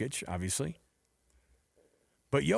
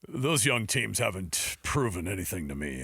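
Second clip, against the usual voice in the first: put-on old school analyst; 7 semitones lower than usual. Host mocks colleague's old school take, low old-timer voice. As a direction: grumble it low like a mock old head. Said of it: "you see how old school J J is?".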